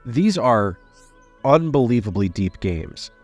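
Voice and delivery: normal talking voice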